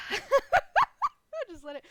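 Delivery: high, slow words through laughter; laughing. Real, not acted. Streamer laughing helplessly as gift alerts keep coming. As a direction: give up and laugh as the alerts roll.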